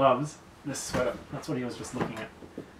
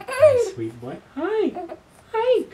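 very high, louder squeaky coo; 14 semitones higher, 5 dB louder, much slower than usual. Real, not acted. Mother greeting her baby son with a high squeaky baby-talk coo.

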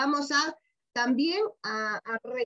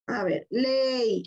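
slow, called-out ley; 2 semitones lower, much slower than usual. Shown as a demonstration. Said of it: "Quiero que vean aquí ley".

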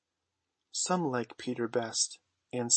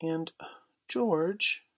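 higher, hesitant wife's voice; timid; 5 semitones higher than usual. A narrator's reading. Wife cautiously questions husband in the kennel, timid. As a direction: broach it gingerly, with pauses. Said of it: "she said timidly".

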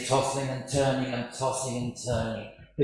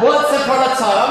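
laughing; 10 semitones higher, 10 dB louder than usual. Real, not acted. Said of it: "I'm sorry, I've done a few of these".

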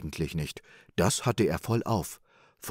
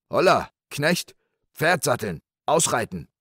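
raised, louder shouted orders; impetuous call; 7 semitones higher, 5 dB louder than usual. Acted. Rich man summoning his servant to saddle up, impetuous. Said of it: "rief der Reiche stürmisch".

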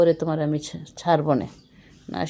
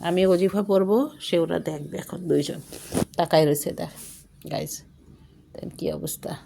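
ordinary delivery

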